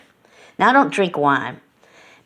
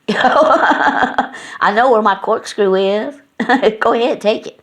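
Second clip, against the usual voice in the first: mock-stern words broken up by laughter; laughing while teasing; 6 semitones higher, 4 dB louder, slower than usual. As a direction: laugh your way through a playful warning. Teasing friend about corkscrew.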